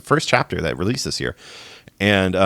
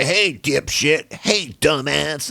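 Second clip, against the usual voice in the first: slow, higher, mocking doll voice; Chucky taunting; 7 semitones higher, much slower than usual. Imitating the killer's in-game taunts: slow mocking voice. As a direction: drawl the insults like a cheeky doll. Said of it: "he's like".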